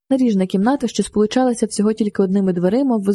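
usual speaking voice

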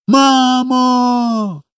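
put-on long, high child's call. A narrator's reading. Little boy calls his mother in the sky, calling out. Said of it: "крикнув Коля".

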